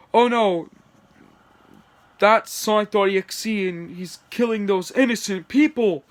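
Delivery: high-pitched mock alarm; acted horrified cry. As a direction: raise it in hammed-up dismay. Bill alarmed at killing.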